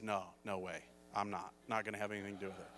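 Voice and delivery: low, quiet retold brush-off; dismissive refusal. Man answering fiancée about going into ministry, dismissive refusal. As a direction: rule it out under your breath, pitched low.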